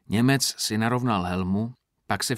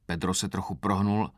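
ordinary delivery, nothing marked

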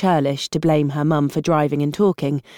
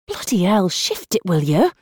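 savage mutter. Acted. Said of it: "she muttered savagely".

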